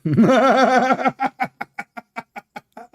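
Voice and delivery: long, high-pitched cackling laugh; big derisive belly laugh; loud mocking laugh. Real, not acted. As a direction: burst out laughing, then let it die away. Laughs at own jeering punchline.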